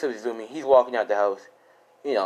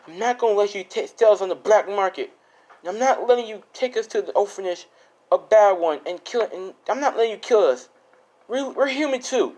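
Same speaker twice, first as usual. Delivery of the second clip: raised-pitch, pleading defiance; defiant protest; 8 semitones higher than usual. Acted. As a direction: stand up to your parents, voice pitched high. Acted defiant son confronting parents.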